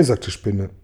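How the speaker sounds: ordinary delivery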